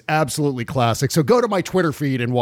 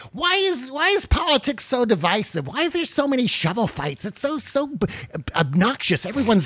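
whiny voter voice; 10 semitones higher than usual. Retold or imitated. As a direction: whine like a fed-up voter. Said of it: "What is this voice?".

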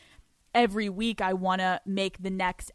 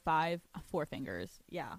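ordinary delivery

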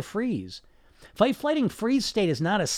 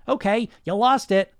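usual speaking voice

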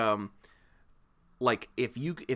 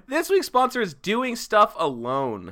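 slow, higher announcer read; 8 semitones higher, slower than usual. Pretend. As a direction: sell the sponsor name slowly. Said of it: "ad read intro".

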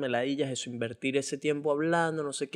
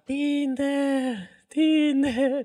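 high, sing-song calling of the app's name; be the phone nagging him; imitating the phone yelling for attention; 12 semitones higher, 3 dB louder, much slower than usual. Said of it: "el teléfono me gritaba".

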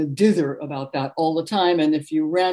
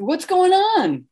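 brash neighbor; 12 semitones higher, 3 dB louder, slower than usual. Retold or imitated. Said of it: "just barge in and say".

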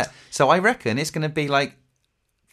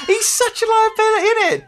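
mock bandmate; 5 dB louder than usual. Mocking bandmate.

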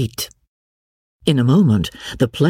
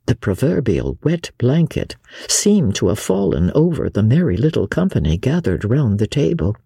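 plain reading voice

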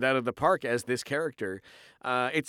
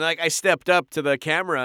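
normal talking voice

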